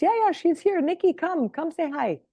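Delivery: high, bright mother calling her daughter over; mom calling out. Retold or imitated. Mother cheerfully calling her daughter to come say hi, high-pitched. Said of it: "My mom goes".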